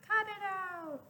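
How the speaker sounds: high, far-off cartoon grumble; annoyed cartoon voice; grumpy rock voice. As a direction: complain like a knocked-on rock. Rock complaining at knocking.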